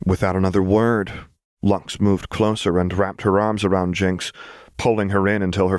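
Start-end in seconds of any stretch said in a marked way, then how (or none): none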